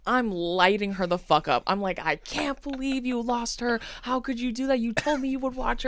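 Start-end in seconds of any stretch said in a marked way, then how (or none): 2.0-5.9 mock screaming at her. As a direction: replay the scolding of the partner in a pitched-up voice. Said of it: "I'm screaming at her partner".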